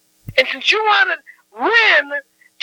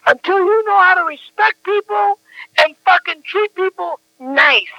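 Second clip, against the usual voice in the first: normal talking voice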